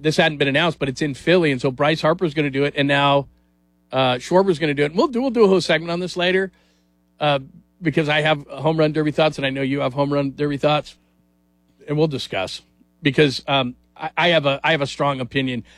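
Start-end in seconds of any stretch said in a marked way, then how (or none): none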